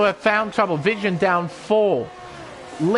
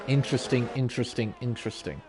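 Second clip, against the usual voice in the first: each one quieter, trailing off; fading repetition; 8 semitones lower, 8 dB quieter, faster than usual. Real, not acted. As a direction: let the word die away. Commentator muses, repeating word fading.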